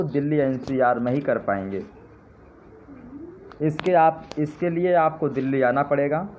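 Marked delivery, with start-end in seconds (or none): none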